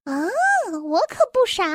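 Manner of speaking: high little elf retort; tiny elf voice. A narrator's reading. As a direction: answer back in a small, high elf voice. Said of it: "细小的声音".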